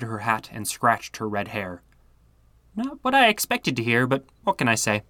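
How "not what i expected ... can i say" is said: put-on young girl's voice. True girl's voice revealed.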